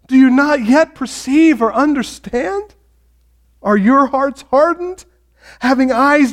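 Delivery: acted righteous frustration, high and insistent; frustrated rebuke. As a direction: read it as a pressing, upset reproach. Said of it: "he's so upset and frustrated and angry".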